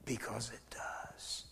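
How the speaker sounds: low, slow, soft whisper. Real, not acted. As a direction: breathe it out like a private confession. Quiet close after the song.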